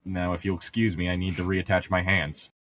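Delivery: ordinary talk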